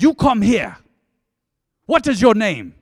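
loud, high South African policewoman's order; angry South African accent. Retold or imitated. South African policewoman pulls over a driver, angry accented shout. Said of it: "I haven't really tried an African accent".

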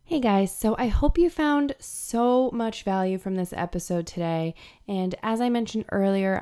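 ordinary talk, nothing marked

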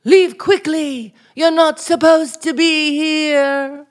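slow, loud, stagey line delivery; melodramatic reading. Acted. Actress rehearsing her lines for the coach, hammed up. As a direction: declaim it like a tragic heroine. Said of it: "go ahead".

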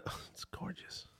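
dreamy whisper. Real, not acted. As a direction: whisper it like a food reverie. Rhapsodizing about stuffing flavors.

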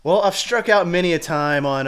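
usual speaking voice

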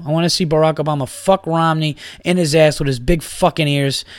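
normal talking voice

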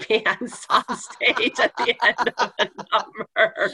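breaking into laughter, drawn out; slow words dissolving into a laugh. Real, not acted. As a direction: tell it through real laughter. Laughs telling her embarrassing climax.

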